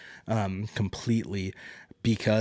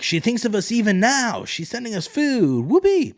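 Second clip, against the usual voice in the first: much higher, hammed-up gushing voice; mock cheer; 12 semitones higher, 6 dB louder than usual. Recapper mocks the small folk cheering Rhaenyra's food, high sarcastic glee. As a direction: sarcastically play the thrilled crowd, peaking on whoopee.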